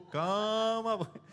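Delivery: alto, rindo, acalmando um boi; acalmando o boi brabo. Retold or imitated. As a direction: ria e peça calma ao boi. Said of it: "parece o boi brabo". (esposa brinca com o marido nervoso, alto e rindo)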